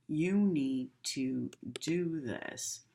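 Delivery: slow, flat, stern warning; cold stern tone. Shown as a demonstration. Teacher showing students a harsh tone, slow and stern. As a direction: make it sound like a scolding. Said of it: "if I speak like this".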